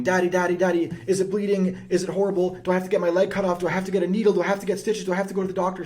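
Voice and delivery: put-on high, loud frightened child crying out to daddy; panicked little girl. Little girl with scraped knee runs to her dad, high panicked voice. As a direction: play a scared kid wailing over a scraped knee. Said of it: "she runs over to you screaming".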